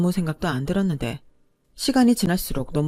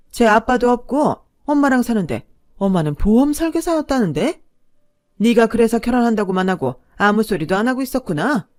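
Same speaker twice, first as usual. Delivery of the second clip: higher, louder mother scolding her son; indignant mother; 4 semitones higher, 6 dB louder than usual. A narrator's reading. Mother confronting her son over his chosen bride, indignant. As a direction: confront him as a scornful, affronted mother.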